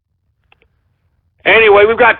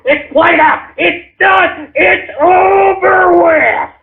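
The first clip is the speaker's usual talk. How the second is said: rising furious yell, dragged out at the end; escalating shout at the trolls; real angry shout; 7 semitones higher, much slower than usual. Real, not acted. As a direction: build to a shout, slowing the last words.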